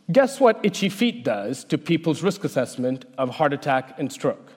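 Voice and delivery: ordinary talk, nothing marked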